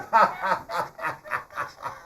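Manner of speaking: acted staccato ha-ha-ha; boy's gleeful mock laughter; prankster's laugh. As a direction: laugh at the people you fooled. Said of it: "there's Kofi laughing again".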